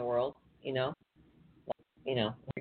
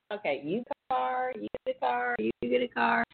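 giddy giveaway host; 7 semitones higher than usual. Retold or imitated. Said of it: "They're like".

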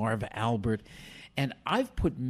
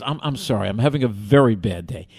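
lower-pitched, apologetic delivery; apologize, composed but still stressed; calmed down; 3 semitones lower, 6 dB louder than usual. Retold or imitated. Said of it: "calms down".

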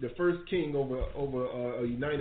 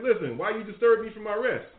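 higher-pitched mock scolding; annoyed Samuel; 6 semitones higher, 4 dB louder than usual. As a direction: act out a prophet irked at being disturbed. Acts out risen Samuel scolding Saul.

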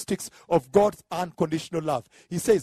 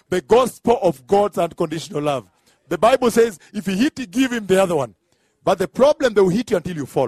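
loud, punchy pulpit delivery; forceful comic preaching; 3 semitones higher, 6 dB louder than usual. Acted. Preacher joking to congregation about turning the cheek, forceful preaching. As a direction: drive it home to the congregation, loudly.

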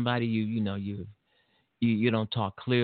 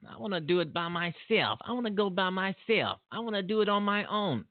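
higher, a lightly voiced stubborn grown son; insistent son; 9 semitones higher than usual. Son telling his father he wants to go alone, lightly mimicked insistence. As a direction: whine it a little, pitched up, set on going alone.

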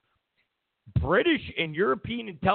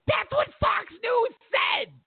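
heated rant; 6 semitones higher, slower than usual. Real, not acted. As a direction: rant at them, high and heated. Angry ranting at reporters.

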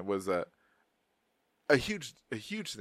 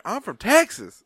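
higher, louder, slow mock Texan drawl; hammed-up Texan; 10 semitones higher, 8 dB louder, slower than usual. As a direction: drag it out like an exaggerated Texan. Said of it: "I don't say it like that".

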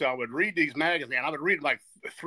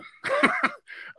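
laugh. Real, not acted. Laughs at his own question.

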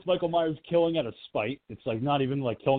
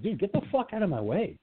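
mildly exasperated, higher-pitched brush-off; annoyed Michael; 6 semitones higher, 3 dB quieter than usual. Acted. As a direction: act out a killer fed up with a pest. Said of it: "And Michael's like".

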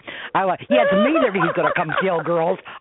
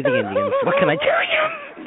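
laughing punchline; slower than usual. Retold or imitated. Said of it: "But, officer".

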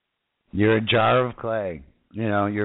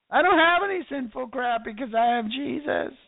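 high, put-on protesting voice; mock objector; 12 semitones higher than usual. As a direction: mimic a self-righteous believer in a high voice. Voices a self-righteous objector.

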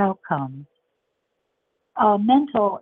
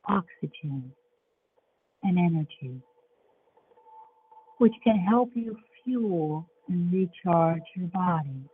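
guided meditation; 5 dB quieter than usual. Acted.

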